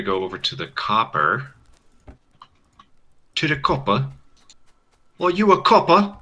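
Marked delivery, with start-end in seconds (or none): 3.4-4.1 mock British pronunciation of copper; British accent. Gamer teasing how the British say copper, mock British accent. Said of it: "Why do the British talk like that". 5.2-6.2 high, loud mock British; hammed-up British questioning voice; British accent. Gamer playing a Brit asking about a copper, high British accent. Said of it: "Why do the British talk like that".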